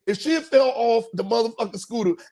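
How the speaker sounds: ordinary delivery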